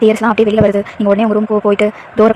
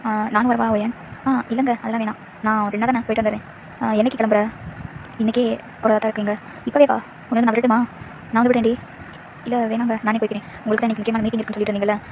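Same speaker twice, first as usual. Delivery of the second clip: subdued wife; 6 dB quieter than usual. Acted. Wife softly declining husband's offer, hiding sadness. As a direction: quietly brush him off, hiding hurt.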